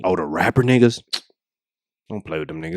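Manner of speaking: ordinary delivery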